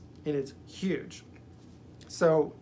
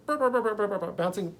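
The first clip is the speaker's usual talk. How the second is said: bouncing sound effect; 4 semitones higher than usual. Retold or imitated. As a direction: make a playful springy burr sound. Imitating bouncing serpent sound.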